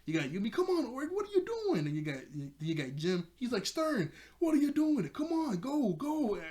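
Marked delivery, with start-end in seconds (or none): 0.5-1.9 very high, exasperated squawk; mock yelling. Girl yelling at the stuck climber, mock yell. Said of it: "everybody just keeps yelling at him". 3.7-6.4 high, raised urging yell. Retold or imitated. Jim yelling at Stern on the wall, urgent yell. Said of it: "everybody just keeps yelling at him".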